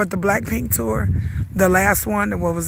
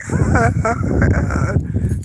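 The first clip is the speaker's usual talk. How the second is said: wheezy, wordless croak; lost-voice imitation; 4 semitones higher than usual. Vlogger showing her end-of-day voice, imitated wordless croaking. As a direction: let out broken croaks of a worn-out voice. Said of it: "my voice is like".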